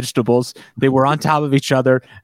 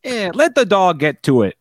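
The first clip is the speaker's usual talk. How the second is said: put-on raised-pitch Chicago cop accent; 4 semitones higher than usual. Cop leaves digging to dog, Chicago voice. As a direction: toss out advice as a Chicago cop. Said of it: "I only do this Chicago voice for a cop".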